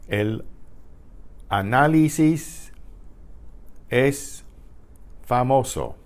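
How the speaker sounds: El, análisis, es, famoso, with clear pauses; word-by-word demo. Shown as a demonstration. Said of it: "Here's a whole sentence".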